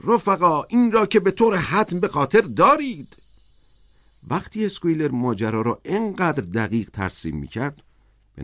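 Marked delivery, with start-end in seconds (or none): 0.0-3.2 high, loud rallying call; loud, ringing appeal to comrades. A narrator's reading. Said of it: "با صدای بلند گفت".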